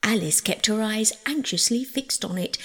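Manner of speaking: ordinary delivery, nothing marked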